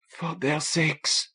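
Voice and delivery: slow, soft, strained half-whisper; hushed, hurting near-whisper; raw whisper. A narrator's reading. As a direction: force it out slowly through a tight throat. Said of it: "Sue replied in a raw whisper".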